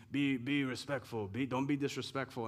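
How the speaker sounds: mocking dad's accent. Grown son mimics dad teaching respect, lightly mocking his accent. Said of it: "he would say it with his little accent".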